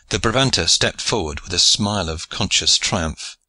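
ordinary delivery, nothing marked

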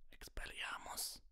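raspy hoarse whisper; croaking. Acted. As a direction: croak the spell softly. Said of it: "krächzte er".

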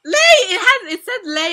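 high, loud shriek of his name; excited shriek. Real, not acted. Fan spotting her bias's name on screen, shrieking. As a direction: yell his name in delight. Spots idol's name, screams.

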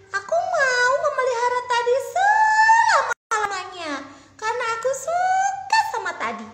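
high, loud tearful cry; passionate vow. Acted. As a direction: declare undying love for a pet. Princess vows to keep frog.

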